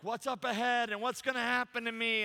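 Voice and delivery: fretful, hammed-up questioning; mock fearful worrying. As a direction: play someone gripped by worry about the future. Fear starts to grip your heart.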